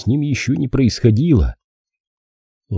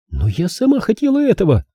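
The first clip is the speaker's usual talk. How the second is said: mild furious cry; 11 semitones higher than usual. A narrator's reading. Girl protests to the detective, understated furious cry. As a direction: protest hotly in a raised girlish voice. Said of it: "яростно крикнула она".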